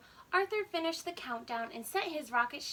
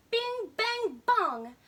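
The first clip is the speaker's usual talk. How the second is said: louder banging-pot sound words; clanging pot noises; 7 semitones higher, 6 dB louder, much slower than usual. Acted. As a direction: bang them out like falling pots.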